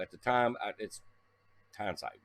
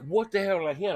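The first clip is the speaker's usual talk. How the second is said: alarmed; 8 semitones higher than usual. Retold or imitated. After hitting something.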